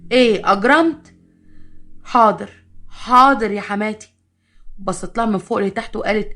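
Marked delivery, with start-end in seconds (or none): 0.1-1.1 slow and loud, biting off each word; angry scolding. Acted. Mother-in-law scolds daughter-in-law. 2.0-4.1 slow, loud, drawn-out resigned compliance; grudging yes. Acted. Daughter-in-law gives in.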